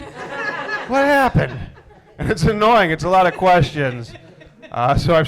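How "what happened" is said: very high, very slow, laughing whine; whiny drawn-out wife. Retold or imitated. Husband mocks his wife's endless plot questions, high and dragged out. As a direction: stretch it out, high and helpless.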